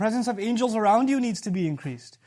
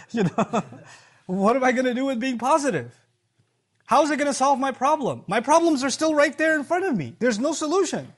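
high, louder mock complaint; exasperated objection; 5 semitones higher, 5 dB louder than usual. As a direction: protest like a frustrated doubter, voice raised. Acting out a frustrated objector.

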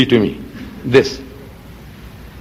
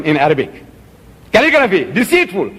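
ordinary talk

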